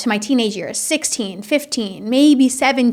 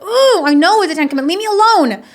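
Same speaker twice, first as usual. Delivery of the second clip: put-on whiny, much higher and louder teen; 8 semitones higher, 5 dB louder than usual. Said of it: "I hated it growing up".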